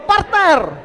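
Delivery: loud, high call to your neighbour. Shown as a demonstration. Pastor leads the crowd calling partner loudly. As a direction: belt it out to the person beside you. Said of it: "sabihin mo".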